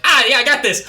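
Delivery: cocky. Acted. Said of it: "you're like".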